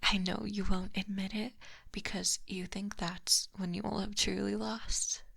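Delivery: ordinary talk, nothing marked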